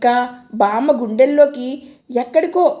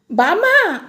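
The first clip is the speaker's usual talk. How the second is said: joyful shout; 6 semitones higher, slower than usual. Acted. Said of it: "వెర్రి ఆనందంతో పెద్ద కేక పెట్టేశాను".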